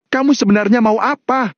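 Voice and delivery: high-pitched cry of protest, little added volume; yelled demand. Acted. John confronts his captor Valentino, crying out. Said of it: "teriak John sambil melotot".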